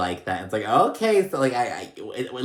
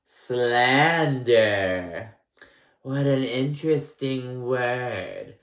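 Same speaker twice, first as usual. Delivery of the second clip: slow, louder mock of Erica savoring the word; 2 semitones higher, 5 dB louder, much slower than usual. Mocking Erica as she taunts Sutton over slander, slow and louder. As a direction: draw out slander like Erica relishing it. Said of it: "And Erica's like".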